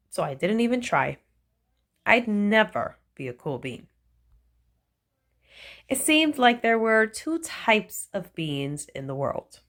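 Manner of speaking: usual speaking voice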